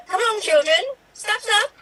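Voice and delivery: brisk, high-pitched nanny call; Mary Poppins. Acted. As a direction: chivvy the children along. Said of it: "you're doing Mary Poppins in one minute".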